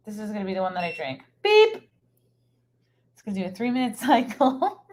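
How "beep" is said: high, loud mimicked machine beep; imitated beep.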